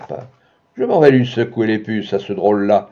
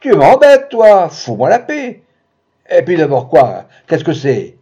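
much higher, louder angry brush-off; snapping at her; 3 semitones higher, 5 dB louder than usual. Acted. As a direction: tell her off in a rage. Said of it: "Il l'envoya coucher". (rembarre-la, furieux, plus aigu et fort)

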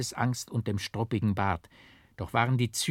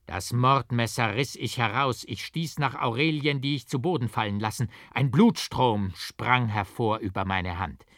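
plain reading voice

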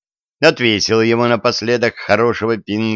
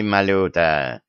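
dismissive; 3 semitones lower, slower than usual. A narrator's reading. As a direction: wave him off with the name. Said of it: "отмахнулся царь". (небрежно, отмахиваясь)

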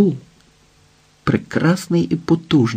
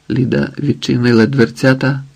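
ordinary delivery, nothing marked

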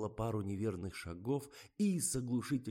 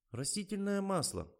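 high-pitched blurted suggestion; sudden blurt; 12 semitones higher than usual. A narrator's reading. Narrator's character blurts an idea to the crew, sudden. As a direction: pipe up out of nowhere. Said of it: "неожиданно ляпнула я".

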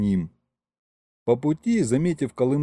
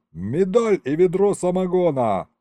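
high, hearty holler; raised jubilant shout; yelled praise; 6 semitones higher than usual. A narrator's reading. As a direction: call out a reward across the crowd. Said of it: "проорал я ему".

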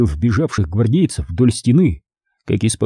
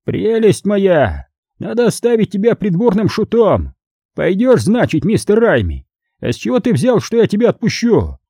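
laughing cardinal; 9 semitones higher than usual. A narrator's reading. Cardinal mocks the prisoner's plan, high and amused. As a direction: savour the joke at his expense. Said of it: "разразился взрывом хохота".

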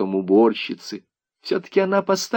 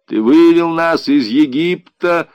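put-on loud, higher-pitched appeal of an old man; crying out; 7 semitones higher, 8 dB louder than usual. A narrator's reading. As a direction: call out like an old man invoking God. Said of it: "старик взывал". (громкий, взывающий возглас старика)

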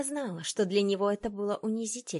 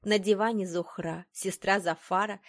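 ordinary delivery

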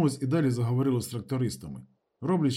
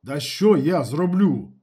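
loud, drawn-out indignant protest; exasperated raised retort; 3 semitones higher, 8 dB louder, much slower than usual. A narrator's reading. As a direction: protest slowly and loudly. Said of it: "обурювався Калюня".

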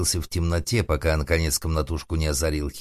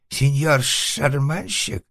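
high, drawn-out, hesitant question; some relief; 10 semitones higher, 4 dB louder, much slower than usual. A narrator's reading. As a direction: ask slowly with cautious relief. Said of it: "с некоторым облегчением спросил". (высокий голос с робким облегчением)

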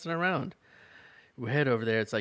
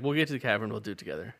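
hurried, tossed-off promise; quick, flat throwaway delivery; rushed through; much faster than usual. Acted. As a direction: race past the line, no weight. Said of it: "Whoa, whoa, whoa! You rushed right past that".

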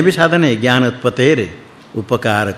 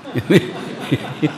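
soft breathy laugh; 2 semitones higher, 5 dB quieter than usual. Real, not acted. Swami laughs at the joke.

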